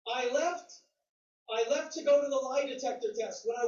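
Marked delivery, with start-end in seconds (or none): none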